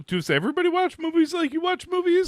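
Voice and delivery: high, loud incredulous outburst; exasperated question. Real, not acted. Co-host baffled by how his friend watches movies, high outburst. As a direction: ask it loudly in disbelief.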